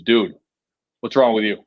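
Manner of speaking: loud, gruff-edged Bostonian father impression; Boston dad. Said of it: "personify sixty-four-year-old Bostonian".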